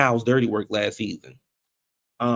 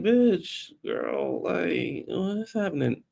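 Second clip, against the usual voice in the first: baffled; 5 semitones higher, 4 dB quieter, much slower than usual. Retold or imitated. Voicing Dorit's confusion.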